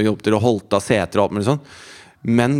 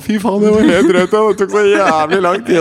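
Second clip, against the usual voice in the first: loud, higher, worked-up relief; breathless exclaiming; 10 semitones higher, 6 dB louder than usual. Retold or imitated. Arriving late on the boat, exclaiming loudly to everyone, breathless. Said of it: "Og jeg kommer inn og".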